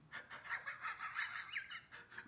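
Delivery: mock wordless wheezing laugh; breathy, voiceless snickering; imitated silent laugh. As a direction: imitate a friend laughing without a sound. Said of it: "when you don't say nothing, you be like".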